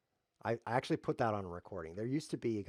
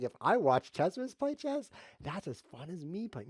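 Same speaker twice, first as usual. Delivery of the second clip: mock viewer; 8 semitones higher, 5 dB louder than usual. Mocking a viewer's thinking.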